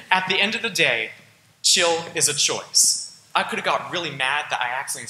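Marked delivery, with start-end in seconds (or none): 1.6-3.2 each word slow and loud; emphatic slow point. Real, not acted. Speaker to class, slow and loud for the motto. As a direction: land the moral hard. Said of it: "emphatic closing point".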